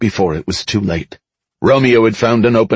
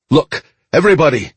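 raised, higher-pitched address; calling to crowd; 8 semitones higher than usual. A narrator's reading. Mickey to the armed crowd, calling for attention.

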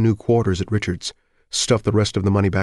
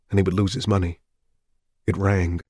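usual speaking voice

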